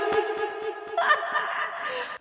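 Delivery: high airless giggle; breathy laugh. Real, not acted. Vlogger laughing at her own joke, airless giggle. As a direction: giggle at yourself.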